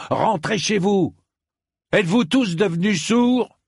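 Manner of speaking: high, urgent bellowed orders; exasperated shouted harangue; roaring. A narrator's reading. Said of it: "mais en rugissant pour le coup".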